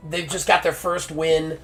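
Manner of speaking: usual speaking voice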